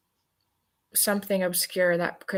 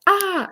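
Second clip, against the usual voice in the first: death gasp; 12 semitones higher, 4 dB louder than usual. Retold or imitated. Retold character suddenly dying.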